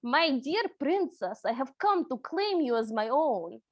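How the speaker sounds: put-on Russian accent. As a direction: play a wolf suitor with a Russian accent. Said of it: "do this with a Russian accent".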